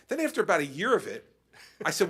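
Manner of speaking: ordinary delivery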